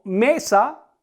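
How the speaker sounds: slow "mesa" with the weight on "me"; stress on ME. Shown as a demonstration. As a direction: lean on the first syllable of "mesa", drawn out. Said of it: "la sílaba en la que recargo la voz es me". ("mesa", recargando la voz en "me")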